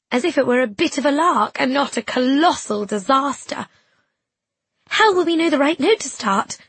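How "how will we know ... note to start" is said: high, loud panicky question; panicked. A narrator's reading. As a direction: blurt it out in panic. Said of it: "I was panicking".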